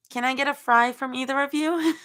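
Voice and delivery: high, sweet wheedle rising along; lightly voiced begging; pleading request. A narrator's reading. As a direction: ask it high and hopeful. Character begs for a fry.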